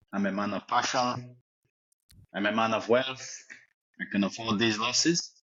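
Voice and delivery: footballer's put-on French accent, lightly done; fake French accent. Interviewed as a fake Giroud, mild French accent. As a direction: play a cocky French striker, accent on.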